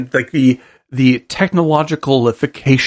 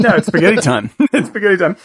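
laughing; 6 semitones higher, faster than usual. Real, not acted. Joking about eating spaghetti.

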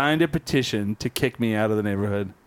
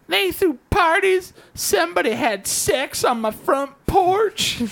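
put-on far higher, slower, a squeaky outraged old crank; mock ranting neighbor; slower than usual. Neighbor complaining to the lady about the snowman, squeaky outraged rant. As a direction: whine out his outrage, hammed up.

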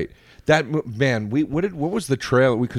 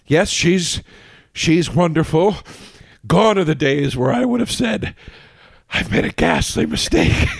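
Frasier impression; 6 semitones higher than usual. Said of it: "he's like".

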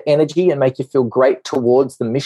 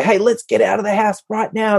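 higher-pitched, urging parent voice; hurrying parent; 8 semitones higher than usual. Retold or imitated. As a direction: chivvy them out the door. Urging a slow kid out.

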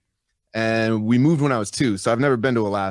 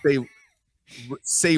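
ordinary talk, nothing marked

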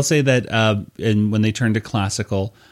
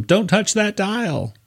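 "Don't touch that dial" much higher and slow, in a put-on voice; Howard Stern voice; 11 semitones higher, slower than usual. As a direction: ham up Howard Stern's warning. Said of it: "Howard Stern tells him".

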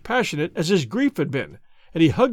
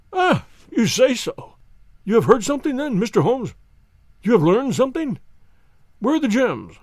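eager hope; 6 semitones higher, 4 dB louder than usual. Acted. Banker presses Holmes for news, eagerly. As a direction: leap at the hope.

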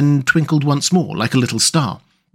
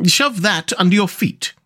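higher character voice giving an instruction; Rat; 7 semitones higher than usual. Acted. Rat handing Mole the basket, high instructing voice. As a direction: lift the pitch, matter-of-fact. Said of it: "he observed to the Mole".

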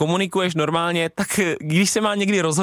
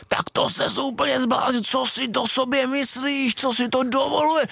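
high, drawn-out imitation of a yelling referee; hammed-up scolding, pitched high and slow; mock angry yell; 8 semitones higher, slower than usual. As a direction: bawl like an outraged ref without real volume. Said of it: "tak na mě začal strašně řvát".

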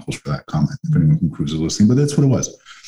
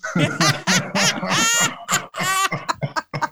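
a long rolling laugh, high and a little softer; 4 dB quieter than usual. Real, not acted. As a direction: laugh through the innuendo. Laughs at cruise innuendo.

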